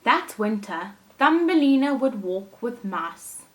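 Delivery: put-on lively storytelling for children; animated read-aloud for little listeners; story narration. A narrator's reading. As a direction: read it like a picture book to a class. Teacher reads story aloud.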